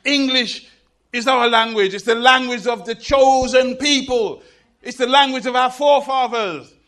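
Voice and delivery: loud, high, full-force speech; George W. Bush. Retold or imitated. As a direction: declaim it like a rousing political speech. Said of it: "I heard him one day giving a speech".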